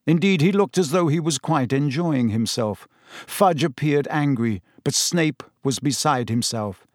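ordinary delivery, nothing marked

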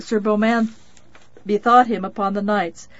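ordinary delivery, nothing marked

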